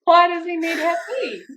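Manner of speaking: high-pitched mock incredulity, laughing midway; baffled protest. Partner challenging the doctor over tattoos, baffled mock protest.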